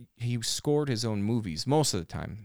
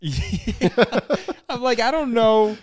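laughing; 11 semitones higher, 7 dB louder than usual. Real, not acted. Laughing through the words.

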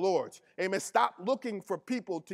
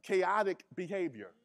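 ordinary talk

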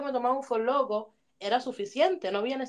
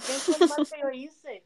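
slower mock-innocent echo, a bit less high; meme quote repeated; 4 semitones higher than usual. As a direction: repeat the meme line, drawn out. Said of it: "como dice el meme".

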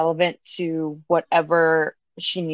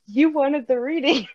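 laughing; 8 semitones higher than usual. Real, not acted. Teasing reply.

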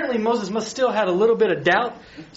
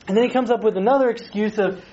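normal talking voice

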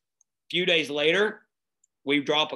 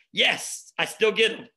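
excited; 6 semitones higher, 3 dB louder than usual. Retold or imitated. Excited reaction to students heading off.

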